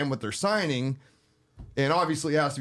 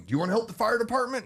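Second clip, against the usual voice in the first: imitating gatherer; 10 semitones higher, much faster than usual. Retelling lady gatherer's pitch.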